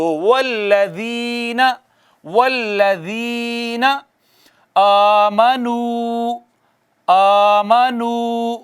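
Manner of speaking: والذین آمنو chanted very slowly, long vowels held; teacher's recite-after-me chant; slow recitation. Shown as a demonstration. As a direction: recite slowly for students to repeat. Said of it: "آپ پڑھ رہے ہیں نا میرے ساتھ".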